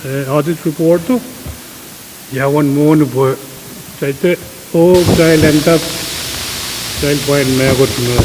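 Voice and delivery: normal talking voice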